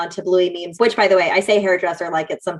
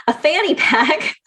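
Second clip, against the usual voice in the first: laughing; 7 semitones higher, slower than usual. Real, not acted. Laughs at own slip.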